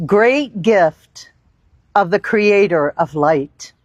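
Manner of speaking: normal talking voice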